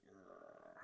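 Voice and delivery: low soft mock growl. Streamer voicing the angry Superman, soft low growl. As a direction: give a low little growl. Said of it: "He's just like".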